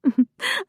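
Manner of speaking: light musical laugh; a dainty rising little laugh; delicate laugh. A narrator's reading. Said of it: "She laughed delicately, musically".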